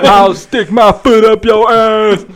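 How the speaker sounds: put-on slow laughing drunk threat; belligerent drunk. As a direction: slur it through a laugh. Imitating Stone Cold Steve Austin.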